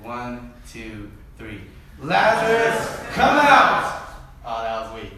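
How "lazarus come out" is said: loud, high, slow group shout; congregation shout. Pretend. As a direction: bellow it together at the tomb. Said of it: "do it in the voice you think Jesus did it in".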